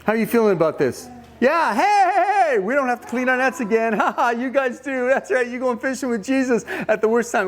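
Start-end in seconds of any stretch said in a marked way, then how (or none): 1.4-7.5 high, laughing jeer; mocking glee. James and John watching Peter go fishing, gleeful teasing. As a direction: crow with mock delight, ha-ha and all.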